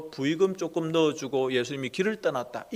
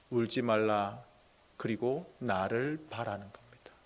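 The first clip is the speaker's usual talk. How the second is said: much softer, lower "don't cry"; soft command; 6 semitones lower, 10 dB quieter than usual. Acted. Jesus quietly telling the widow not to cry. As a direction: hush her tears gently.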